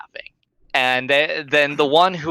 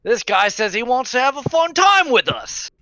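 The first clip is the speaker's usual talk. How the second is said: put-on rowdy drunk; 12 semitones higher than usual. Drunk patron taunts the player before his buddies, high jeering. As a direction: jeer at the newcomer like a tipsy bully.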